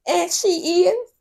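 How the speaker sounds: high, loud sassy jab; sassy aside. Real, not acted. Host butting in on the couple, loud and sassy. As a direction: snap it at the screen. Snaps back at the screen.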